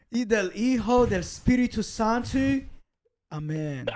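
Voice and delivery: mock-solemn Spanish blessing, dropping on the amen; priest from The Exorcist. Finishing the super chat blessing, mock priestly intoning. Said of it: "sound like the priest from The Exorcist".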